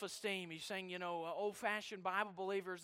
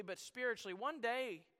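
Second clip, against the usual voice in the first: usual speaking voice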